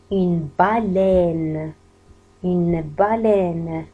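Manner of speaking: very slow "Une baleine" with open è; outward open è. Shown as a demonstration. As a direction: stretch "baleine" for learners, vowel pushed outward. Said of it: "وكأنه الإي هذيك خرج فيها برا للخارج".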